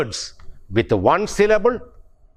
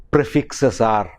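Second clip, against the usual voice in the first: ordinary talk, nothing marked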